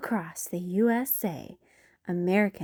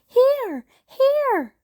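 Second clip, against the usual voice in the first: high, loud calls for candy; child hollering for candy; shouting; 8 dB louder than usual. Acted. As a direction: wave and yell at the mayor. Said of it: "I shouted".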